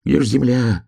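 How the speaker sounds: mild panic rising from a near whisper; hare's anxious thought. A narrator's reading. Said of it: "думал заяц".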